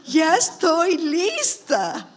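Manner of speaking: loud, high, long-drawn-out mock surrender; mock self-quote. Preacher mocking her own readiness to go, loud exaggerated drawl. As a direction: stretch every word in exaggerated self-mockery.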